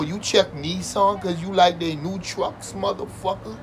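ordinary talk, nothing marked

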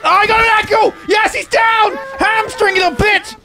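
high, excited battle cry; high-pitched victory yell; triumphant shout. Real, not acted. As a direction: crow over a downed enemy. Triumph in game fight.

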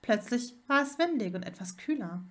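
ordinary delivery, nothing marked

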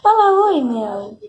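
high squeaky coaxing to the cat. Real, not acted. Coaxing cat to greet viewers. (voz de bebê para o pet)

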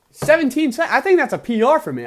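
high, loud burst of excitement; excited, high-pitched exclamation. Real, not acted. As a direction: blurt it out, thrilled at a new record. New personal record at Minesweeper.

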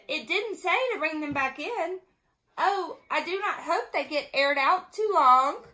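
lively, emphatic storybook reading; animated read-aloud with eager quoted lines; reading to children. A narrator's reading. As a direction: read it out brightly for little listeners.

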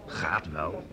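softly parroted with a questioning lift; mocking echo. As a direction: echo his words back with a teasing lilt. Friend mockingly echoes the reply.

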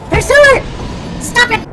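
high-pitched shout at an enemy; furious yell. Real, not acted. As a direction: scream at the boss to quit. Yelling at the boss.

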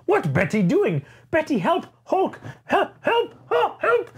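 high, slow, whimpering Hulk voice; a wounded brute pleading in a high whine; pitiful Hulk. Retold or imitated. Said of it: "he's like".

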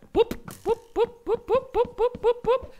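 high, bouncy pup pup sounds; mole noises. Acted. Gamer voicing his mole strategy, playful high pup sounds. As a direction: play a digging mole. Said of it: "La nueva estrategia del topo".

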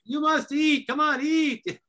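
hammed-up coaxing to eat; old Italian mother. Old Italian mother urging someone to eat, high hammed coaxing. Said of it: "the old Italian mother".

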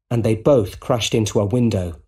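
ordinary delivery

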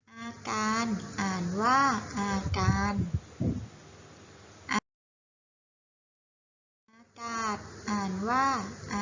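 measured word-by-word reading with long pauses; reading drill. Shown as a demonstration. Teacher leads children through a basic word list, careful drill reading. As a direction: read each word out carefully for pupils to repeat.

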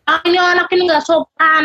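high-pitched mock scolding; disapproving grandparent. Uncomprehending elder judging the child, mock disapproval. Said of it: "kakek nenek-nenek nggak ngerti".

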